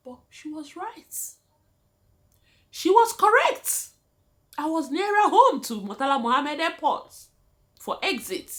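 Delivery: high, loud, eager telling; excited exclaim. Real, not acted. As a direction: burst out that she was right. Excited admission of the manager's point.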